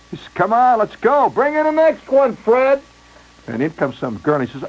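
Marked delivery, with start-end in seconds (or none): 0.3-2.8 rushing photographer. Retold or imitated.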